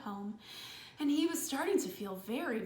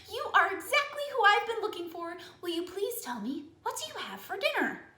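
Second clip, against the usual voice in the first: higher, fast, eager little voice; excited child; 7 semitones higher, faster than usual. Acted. Elephant child thrilled to meet the crocodile, high and fast. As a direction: bubble over with joy.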